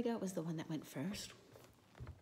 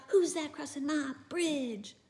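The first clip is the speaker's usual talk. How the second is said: high, louder troll challenge; troll shout; 9 semitones higher, 6 dB louder, slower than usual. Acted. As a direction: jump out and bellow at the goat. Troll jumps out at big goat.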